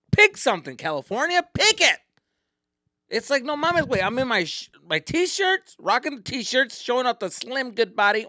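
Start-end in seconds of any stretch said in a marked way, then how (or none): 0.1-2.0 high, loud exasperated demand. Real, not acted. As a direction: yell at California to choose. Demanding weather pick.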